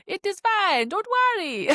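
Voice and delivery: put-on high, bouncy Spanish accent slipping into Super Mario Italian; a botched Spanish accent that turns Italian; attempted Spanish accent. To the anxious traveller, the border guard in a bad Spanish accent. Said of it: "turn that attempted Spanish accent into, like, a Super Mario Italian".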